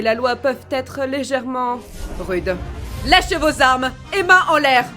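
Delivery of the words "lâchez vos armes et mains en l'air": loud, high command to surrender; forceful raised-voice order; shouted police order. Acted. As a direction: shout it at armed suspects. Police officer ordering criminals to surrender.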